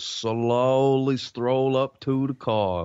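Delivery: drawn-out, leisurely delivery; slow stroll. Shown as a demonstration. Cop explaining the strut to a colleague, slow drawl. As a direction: stretch the words like a slow strut.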